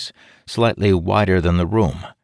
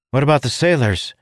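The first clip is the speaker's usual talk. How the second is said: urgent raised call, higher; shouted question; 9 semitones higher, 3 dB louder, faster than usual. Acted. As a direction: call out in alarm. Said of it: "Ilden shouted".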